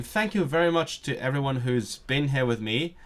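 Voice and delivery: ordinary talk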